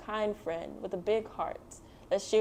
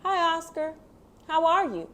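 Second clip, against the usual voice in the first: higher, bright friendly hello; cheerful greeting; 8 semitones higher, 4 dB louder than usual. Acted. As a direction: greet a friend you missed.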